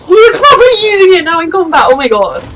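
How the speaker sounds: loud, high, thrilled outburst; excited shout. Real, not acted. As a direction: shout it out, swept up by the fight. Excited reaction to fight scene.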